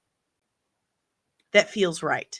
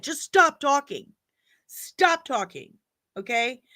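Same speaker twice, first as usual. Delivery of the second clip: high, falling, fed-up plea; exasperated plea; 6 semitones higher than usual. Real, not acted. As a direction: beg them to shut up, pitched high. Begs filmmakers to stop talking.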